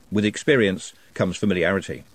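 ordinary delivery, nothing marked

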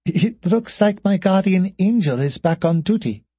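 high, lilting woman's voice; amused tease, pitched well up; giggly amusement. A narrator's reading. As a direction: say it lightly after a small giggle. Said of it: "let out a small giggle".

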